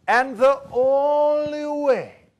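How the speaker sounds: hammed-up mystic build-up; dramatic proclamation. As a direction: announce it like a prophecy. Salesman's dramatic prophecy.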